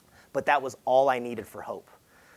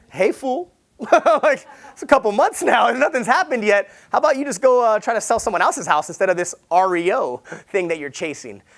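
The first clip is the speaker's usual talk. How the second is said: high, loud, laughing wife voice teasing; mocking wife; 7 semitones higher, 7 dB louder than usual. Wife laughing at her husband for chasing the dream, high and loud. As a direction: tease him like a wife who has had enough. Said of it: "my wife's like".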